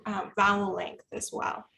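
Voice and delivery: ordinary delivery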